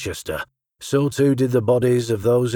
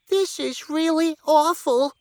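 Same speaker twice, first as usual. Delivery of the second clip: very high, slow little pig voice; distressed Wilbur. A narrator's reading. Wilbur watching Zuckerman approach, very high and anxious. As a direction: whimper it like a frightened piglet.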